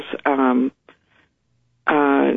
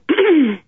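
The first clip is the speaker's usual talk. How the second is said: throat clearing; 12 semitones higher than usual. Real, not acted. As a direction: clear your throat roughly. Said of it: "that's my early morning clearing of throat".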